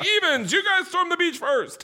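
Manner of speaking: high mock announcer voice calling out the teams; hammed-up bossy voice. Producers announcing to the contestants, in a high mock announcer voice.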